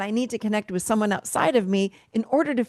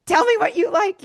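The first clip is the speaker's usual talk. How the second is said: high, loud, pushing hard for an answer; pressing, raised demand to a client; insistent demand; 10 semitones higher, 5 dB louder than usual. Retold or imitated. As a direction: hammer the question home, raised voice. Pressing women to name preferences.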